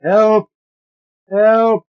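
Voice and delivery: high, loud shout for help; a voice trapped in the body calling for help; desperate cry for help. A narrator's reading. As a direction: scream for rescue from deep inside. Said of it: "a new voice is calling deep in me".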